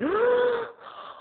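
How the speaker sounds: high shocked mock gasp; offended gasp. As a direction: gasp as if deeply insulted. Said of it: "And she went".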